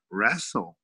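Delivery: normal talking voice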